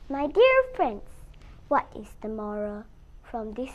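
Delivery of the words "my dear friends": ordinary delivery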